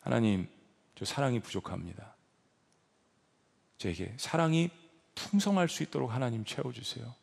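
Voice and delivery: soft, humble prayer spoken to God; quiet prayer. Shown as a demonstration. Preacher demonstrating a quiet prayer, softly. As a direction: pray it quietly, as if alone before God.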